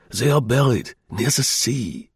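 put-on slow, German-accented disclosure; confiding German agent. German agent revealing the hiding place, slow and accented.

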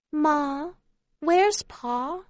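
put-on high, small child's question. A narrator's reading. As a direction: ask like a little kid. Small child asks for father.

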